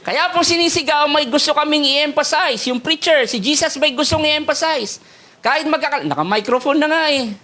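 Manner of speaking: preaching at full force. Acted. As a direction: lift the voice high and push. Preacher raising voice about shouting.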